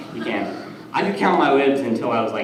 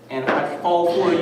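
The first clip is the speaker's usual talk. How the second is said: usual speaking voice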